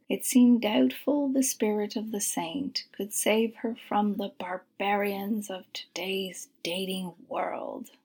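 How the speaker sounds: ordinary delivery, nothing marked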